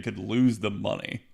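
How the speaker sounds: ordinary talk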